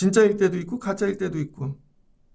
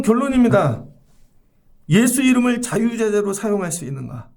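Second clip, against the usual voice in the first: usual speaking voice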